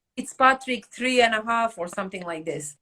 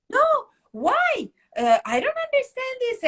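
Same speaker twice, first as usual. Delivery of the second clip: high-pitched retold protest; upset client; 12 semitones higher than usual. Client protests losing her appointment, upset. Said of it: "She was so upset".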